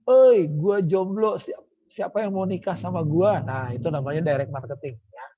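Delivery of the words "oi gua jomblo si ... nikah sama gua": playful shout for attention; mock shout. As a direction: holler it out to the whole room. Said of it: "terus Anda teriak".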